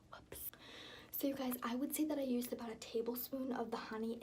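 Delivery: soft breathy whisper throughout; hushed relaxed bath whisper; whispery voice. Real, not acted.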